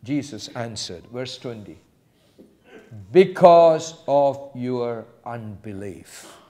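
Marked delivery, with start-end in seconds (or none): 3.1-6.4 slow, weighty, final verdict; solemn categorical pronouncement, drawn out. Acted. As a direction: lay it down slowly as settled fact. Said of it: "Jesus said that very categorically".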